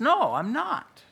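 acted high protest; high-pitched denial. Preacher acts Peter's second denial.

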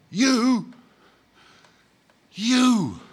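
high, breathless outraged gasp; gasped in shock. A narrator's reading. Ghost to the spirit who insulted him, a gasping outburst. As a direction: choke the words out in disbelief. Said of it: "gasped the ghost".

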